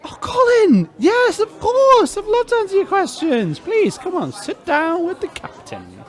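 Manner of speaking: very high, bubbly greeting; beaming, overeager hello to the radio host; gushing welcome. Acted. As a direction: gush over the host like a thrilled fan. Captain greets host effusively.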